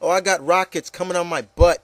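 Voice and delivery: ordinary delivery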